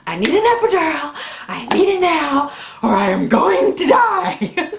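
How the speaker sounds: loud, high desperate yell; retold screaming. Labouring woman demands epidural from husband, desperate loud high screaming. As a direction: re-enact a frantic demand for relief. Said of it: "I started screaming".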